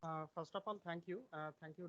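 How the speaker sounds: very faint, far from the mic; faint voice, hard to hear; barely audible. Real, not acted. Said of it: "your voice is very low".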